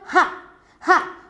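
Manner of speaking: "Ha, ha" as a breathy isolated H sound. Shown as a demonstration. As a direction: pull out only the first sound "Ha". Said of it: "What is the first sound".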